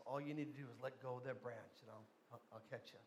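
hushed, low voice of God; voice from heaven. Retold or imitated. God calmly instructs the dangling man, hushed heavenly voice. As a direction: speak very softly and low. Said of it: "he hears this voice that says".

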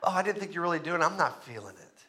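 quick, soft backpedalling; mock reluctant. Imagined Peter backing out after being called.

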